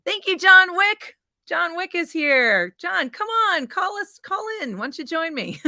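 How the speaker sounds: high-pitched, delighted shout-out; playful high-pitched beckoning; excited calling out. Real, not acted. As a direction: call to a guest, high and excited.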